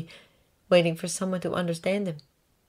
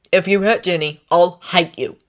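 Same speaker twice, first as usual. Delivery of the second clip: stern warning; 7 dB louder than usual. Acted. Ron threatens Harry over sister.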